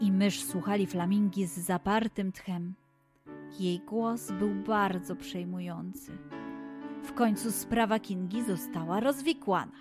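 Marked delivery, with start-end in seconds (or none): none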